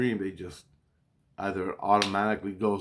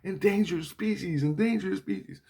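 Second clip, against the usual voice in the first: lightly sirened chant, higher pitch; imitated alarm; 10 semitones higher than usual. Alarm going off after cutting his leg, higher siren chant. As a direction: repeat it like a mechanical alarm. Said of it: "an alarm went off".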